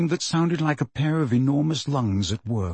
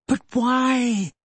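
near tears; 9 semitones higher than usual. A narrator's reading. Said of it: "sounded as though she wanted to cry".

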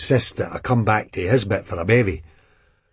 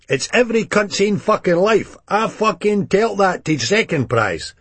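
raised, rough Edinburgh hard man; 10 semitones higher than usual. A narrator's reading. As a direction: play the hard man, half shouting. Begbie voiced in the story.

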